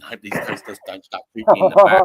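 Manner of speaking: loud, fast, high panic; fast, loud inner panic; panicked thought. Retold or imitated. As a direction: blurt it out while fleeing. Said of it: "I'm just thinking".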